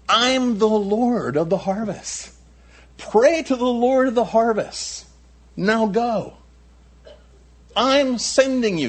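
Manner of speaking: high, drawn-out, ringing proclamation; Jesus commanding. Acted. Preacher voices Jesus commanding his disciples.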